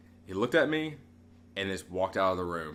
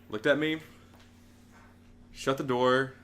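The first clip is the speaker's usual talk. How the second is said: usual speaking voice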